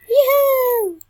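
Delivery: high, loud sing-song yoo-hoo; calling out. Acted. Character calling out to the player, loud and high. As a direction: call out cheerfully to get attention.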